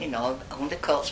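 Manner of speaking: ordinary talk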